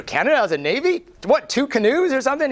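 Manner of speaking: mock-incredulous joke; joking jab. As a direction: rib him with a cheap joke. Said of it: "so I do the joke".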